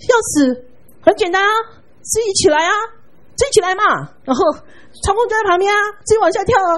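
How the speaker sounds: high, loud, scornful taunting; stern rebuke. Retold or imitated. Mother scolds her daughter from behind, high and scornful. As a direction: throw her words back at her.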